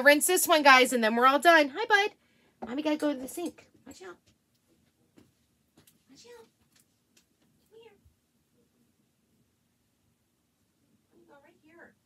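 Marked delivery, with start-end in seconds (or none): 1.7-11.9 soft pet talk. Real, not acted. Mom softly coaxing her dog to keep her company. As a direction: coax the pup over gently.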